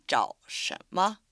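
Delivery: 找什么 with 找 rising, second tone. Shown as a demonstration. Teacher demonstrates 找什么 tone change slowly. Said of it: "前にある找が二声に変化するので".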